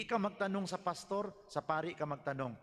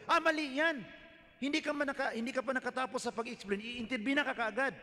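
hasty objection; 6 semitones higher than usual. Retold or imitated. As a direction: blurt it out to cut him off mid-sentence. Imitating interrupting Catholics.

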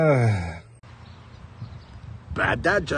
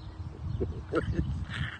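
breathy wheezing chuckle; corny dad laugh; 4 semitones higher, 8 dB quieter than usual. Real, not acted. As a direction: wheeze a guilty little laugh. Laughs at own dirty joke.

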